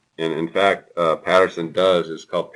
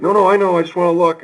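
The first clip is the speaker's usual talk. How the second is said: put-on high, louder kid insisting; eager little boy; 13 semitones higher, 6 dB louder, faster than usual. Father imitating son wanting to see the toy bag. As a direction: beg to peek like a small child. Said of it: "And he's like".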